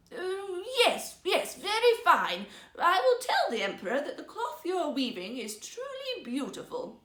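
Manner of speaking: high, loud, forced enthusiasm with a laugh; nervous bluffing old man. Acted. Old minister pretending to see the cloth, nervously gushing. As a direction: cover panic with gushing praise.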